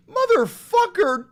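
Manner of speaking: high, loud exasperated swear; exasperated outburst. Real, not acted. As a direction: blurt it out in disbelief. Outburst on reading birth country.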